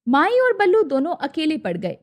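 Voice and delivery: usual speaking voice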